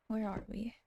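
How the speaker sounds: ordinary talk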